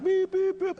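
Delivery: high-pitched mimicked honks; imitated truck horn. Preacher telling of a big truck coming, honking. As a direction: honk a warning with the voice.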